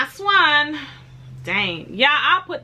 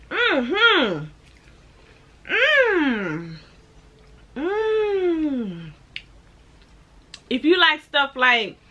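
speaking between bites; chewing while talking; 5 semitones higher, much slower than usual. Real, not acted. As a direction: mouth partly full of cookie. Seen 0: Bites cookie at -12, chews with closed mouth, then talks mid-chew.

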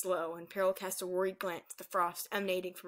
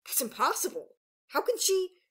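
higher, startled exclamation; shouted disbelief; 9 semitones higher, 4 dB louder than usual. A narrator's reading. Elders crowding round the baby dragon, shouting in disbelief. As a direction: cry out in alarmed disbelief. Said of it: "awoken by a great shout".

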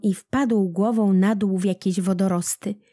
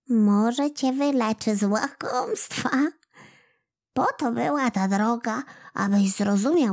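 put-on gentle old woman; 3 semitones higher than usual. A narrator's reading. Old woman teaches Kłobuk.

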